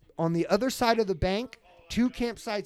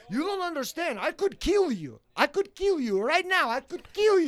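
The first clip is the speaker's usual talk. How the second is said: high-pitched mock scream without much volume; retold screamed threat; 10 semitones higher than usual. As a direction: imitate an irate drunk threatening, voice high. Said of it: "he's screaming in my face".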